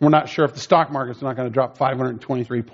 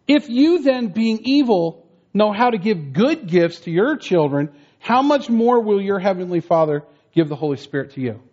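solemn scripture reading; 5 semitones higher than usual. Acted. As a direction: lift the verse up like a preacher proclaiming it. Reads scripture with raised voice.

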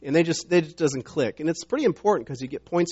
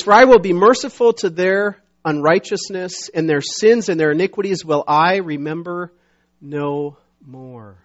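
loud, emphatic verse reading; declaimed Bible passage; scripture reading; 2 semitones higher, 7 dB louder than usual. Acted. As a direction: proclaim the verse, settling down at the close.

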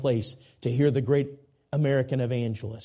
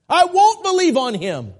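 shrill, much higher and louder defiant cry; mock defiant refusal; 11 dB louder than usual. Preacher voicing a defiant unbeliever to the congregation, high loud mock cry. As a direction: fling the refusal out like a stubborn rebel. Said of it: "anyone here this morning that would say".

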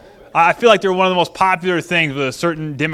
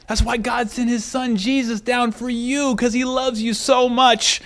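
consoling; 6 semitones higher than usual. Retold or imitated. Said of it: "I finally console her".

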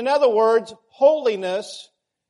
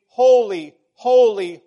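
each "holy" hit louder with a falling tune; emphatic repetition; 6 dB louder than usual. Shown as a demonstration. Preacher showing Hebrew emphasis by repeating "holy". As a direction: land hard on "holy, holy". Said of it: "if they want to emphasize it".

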